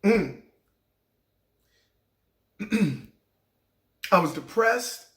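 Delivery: usual speaking voice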